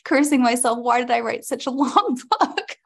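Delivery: high, loud laughing through the words. Real, not acted. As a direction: laugh it out, cursing yourself. Laughing about her long audiobook.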